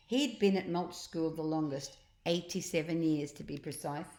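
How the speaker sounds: ordinary delivery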